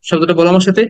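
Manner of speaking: usual speaking voice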